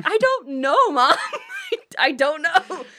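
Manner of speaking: laughing exasperation. Retold or imitated. She can't answer mom's questions, laughing and exasperated. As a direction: throw up your hands at mom.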